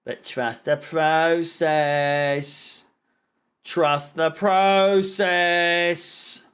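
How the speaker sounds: mock-solemn, very slow drawn-out chant; sarcastic. Fan mocking his club's manager's slogan, drawn-out sarcasm. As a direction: stretch each word out sarcastically, long held vowels. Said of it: "I was being sarcastic".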